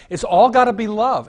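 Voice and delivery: high, loud scornful jab; high, loud mocking outburst. Real, not acted. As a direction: throw the phrase out in loud scorn. Mocking liberal view, peak.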